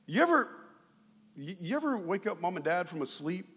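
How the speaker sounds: ordinary talk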